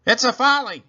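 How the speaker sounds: high, loud indignant cry; fist-shaking scold. A narrator's reading. Eugenia fuming at the neighbours, indignant. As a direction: shake your fist as you say it. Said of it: "She shakes her fist".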